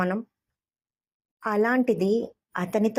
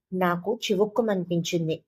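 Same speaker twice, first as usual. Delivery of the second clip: ordinary delivery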